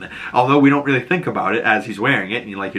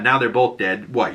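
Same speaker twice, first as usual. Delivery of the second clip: usual speaking voice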